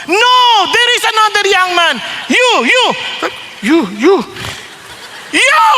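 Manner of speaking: put-on jab each you at the young man; preacher pointing. Preacher singling out the man in the crowd, rising call. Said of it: "Bigla pa namang".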